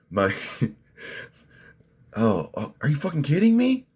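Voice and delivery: are you kidding me, outraged; incredulous outburst. Real, not acted. Ranting to viewers about the doctor, incredulous outburst. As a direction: pitch shoots way up in disbelief.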